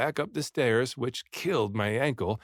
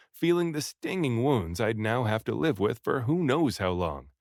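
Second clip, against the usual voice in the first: usual speaking voice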